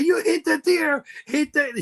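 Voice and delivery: high-pitched panicked yell again; mock yelling. Owen pranking the driver, high panicked yell. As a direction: shriek it in fake panic. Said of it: "And he said".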